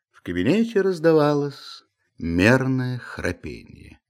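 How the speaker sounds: ordinary delivery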